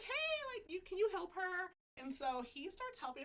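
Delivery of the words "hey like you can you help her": quoting a friend's high, singsong "hey"; high-pitched call. Retold or imitated. Josie asks him to help her, high-pitched call. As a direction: call out high, imitating a friend asking a favour.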